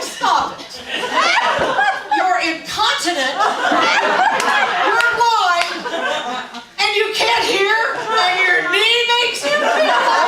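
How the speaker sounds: high, loud mock scolding, laughing; laughing mock scold of mom; loud teasing scold. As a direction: tease her loudly so she hears. Scolding a deaf mother.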